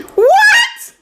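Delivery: high laughing disbelief; shocked laughing what. Real, not acted. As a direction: squeak out a stunned what. Friend likes the juice.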